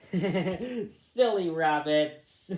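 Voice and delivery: slow words through real laughter; laughing. Real, not acted. Reader laughing at the fleeing rabbits. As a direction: laugh as you tease the rabbits.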